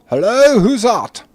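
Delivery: loud, very high answering holler; voice calling back. Acted. Unseen cleric answers visitors from downstairs, calling back. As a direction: shout back up from below. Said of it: "you hear a voice coming back".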